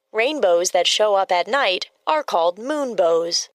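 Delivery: usual speaking voice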